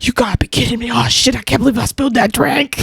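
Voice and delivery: mildly acted exasperated cursing; imitated angry cussing. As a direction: retell a dad's angry outburst. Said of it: "ever heard him cuss so much".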